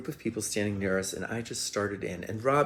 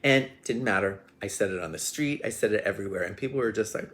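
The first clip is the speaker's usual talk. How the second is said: ordinary delivery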